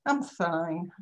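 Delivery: flat unhappy fine. Shown as a demonstration. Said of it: "not, not fine, yeah?".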